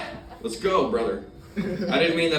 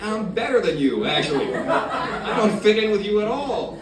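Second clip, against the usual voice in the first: loud, high-pitched dismissive retort; indignant superiority; 10 semitones higher, 5 dB louder than usual. Acted. Newcomer telling the addicts' meeting off, indignantly superior. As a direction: put them down loudly, stretching the end.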